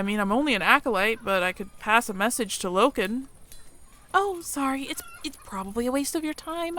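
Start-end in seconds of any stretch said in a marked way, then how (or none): 4.1-6.8 high, timid voice tripping over apologies; a nervous mouse apologising too much; flustered shy mouse. Acted. Mia fumbling her words to the human, flustered and high.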